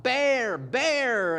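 imitated panicked shout; retold yelling. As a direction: call out the warning like a startled camper. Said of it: "they start yelling out".

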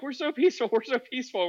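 high, put-on protesting voice; mock peaceful Muslims. Streamer imitates debaters claiming peace, in a high mock voice. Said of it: "the really peaceful Muslims are like".

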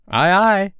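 high, loud, carefree answer; playful boy in a guessing game; blithe boy. A narrator's reading. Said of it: "he answered blithely in his own voice".

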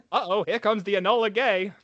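put-on old newsreel voice; hammed-up old-timey announcer; Mid-Atlantic accent. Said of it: "I'll sometimes do that voice".

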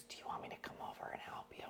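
quick hushed offer; eager whisper. Retold or imitated. Imitating Wendy offering help in a quick whisper. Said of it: "She goes".

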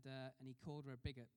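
normal talking voice

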